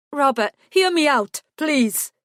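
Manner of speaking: high, loud plea; begging. A narrator's reading. Werewolf begging the detective, high loud plea. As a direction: raise the voice to beg.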